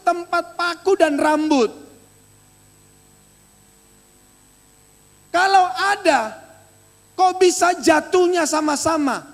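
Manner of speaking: emphatic high-pitched sermon delivery; impassioned preaching. Real, not acted. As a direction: preach the story with mounting insistence. Dramatic preaching with raised voice.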